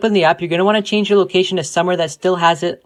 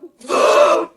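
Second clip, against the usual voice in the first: sharp, high, loud gasp; shocked gasp; 7 semitones higher, 6 dB louder than usual. Acted. Girlfriend caught out, high loud gasp. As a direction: gasp in mock horror. Shocked by text message request.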